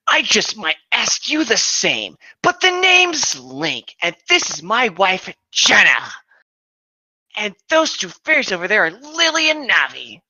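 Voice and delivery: a wary hero introducing his party; cautious Link. Acted. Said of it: "Link said with a hint of caution".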